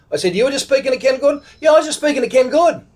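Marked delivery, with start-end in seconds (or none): 0.3-1.4 high, rushed disbelieving echo; incredulous question. Retold or imitated. Disbelieving echo of stranger's news. 1.6-2.9 high, quick insistent reply; excited confirmation. Retold or imitated. Stranger confirms the astonishing coincidence.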